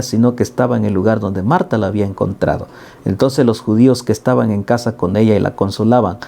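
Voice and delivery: ordinary delivery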